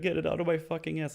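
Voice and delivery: high, quick retold plea; frantic demand. Retold frantic demand while cornered.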